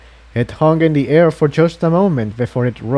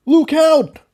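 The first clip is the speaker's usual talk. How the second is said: high, louder urgent cry; warning shout; 3 dB louder than usual. A narrator's reading. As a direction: yell a sudden warning. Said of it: "Jigsaw warned".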